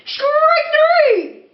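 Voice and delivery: high, loud "Strike three!" call; umpire's yell. Acted. Umpire calls the final strike, loud yell. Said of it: "yelled the umpire".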